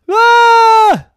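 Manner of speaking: high, loud shriek of fright; scared scream. Acted. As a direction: shriek in terror.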